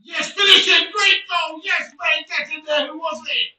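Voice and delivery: high, very loud celebration; excited goal shout. Real, not acted. Gamer cheering his goal in the match, excited shouting. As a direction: explode with joy at the goal.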